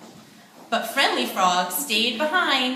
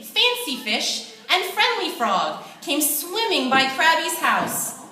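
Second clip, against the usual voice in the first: ordinary delivery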